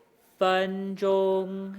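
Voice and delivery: "分钟" slow, flat high first tones; practicing tones. Shown as a demonstration. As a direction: hold "分钟" level throughout. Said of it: "It's not changing or wavering at all".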